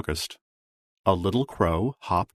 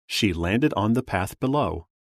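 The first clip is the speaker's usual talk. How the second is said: plain reading voice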